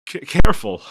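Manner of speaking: sudden loud, high stammered cry; alarmed warning. Acted. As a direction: blurt it out in alarm. Sudden warning to partner walking.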